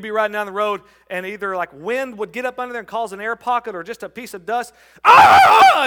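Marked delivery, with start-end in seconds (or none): 5.0-5.8 laughing, much higher, loud cry of pain; mock pained yelp. Preacher retelling the contact lens mishap, mock yell. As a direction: yelp as dust hits the eye.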